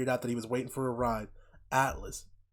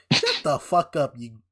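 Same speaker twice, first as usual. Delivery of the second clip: louder, slow disgusted scolding; mock scolding; 2 semitones higher, 6 dB louder, slower than usual. Real, not acted. Viewer telling off the hillbilly family, loud and slow. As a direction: slam them with scorn.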